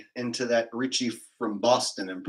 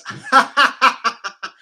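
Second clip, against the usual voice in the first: loud, high staccato mock laugh; imitated emoji laughter; 10 semitones higher, 9 dB louder than usual. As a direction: act out a laughing emoji, hammed-up. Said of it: "this is what the laughing emojis are doing".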